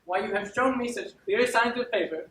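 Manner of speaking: indignant, building accusation; aggrieved demand. Acted. Wronged steward demanding an explanation from his lady, mounting indignation. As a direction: lay out the evidence with mounting outrage.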